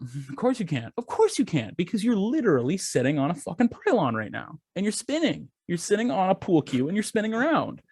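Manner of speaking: mock exasperation, pitch jumping on the repeat; exasperated retort. Telling off the useless phone agent, exasperated. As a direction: climb into a high, sarcastic rant.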